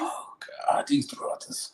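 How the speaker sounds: soft, slow whispered aside. Real, not acted. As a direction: shake your head and whisper it to yourself. Muttering at the commenters.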